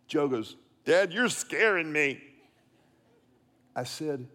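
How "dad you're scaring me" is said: mock-frightened child complaining to Dad; imitated little boy. Second grader working on his scolded dad, mock scared. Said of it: "what my little boy said in second grade".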